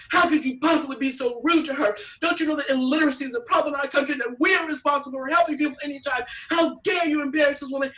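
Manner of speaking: high, fast indignant lecture; mock outraged scolding. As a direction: scold her like shocked do-gooders. Appalled crowd lambasting the woman.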